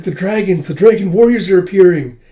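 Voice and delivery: high, loud announcement; excited crowd cry. Retold or imitated. Crowd announces the dragons' arrival.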